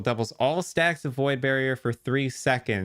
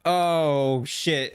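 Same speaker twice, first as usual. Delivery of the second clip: excited; 3 semitones higher than usual. Real, not acted. Reacting to rare drop.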